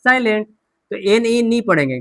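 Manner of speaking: normal talking voice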